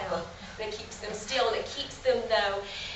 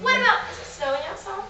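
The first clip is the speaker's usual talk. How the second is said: put-on kids show host; 6 semitones higher, faster than usual. Shown as a demonstration. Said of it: "I'm talking to you once again like the normal age".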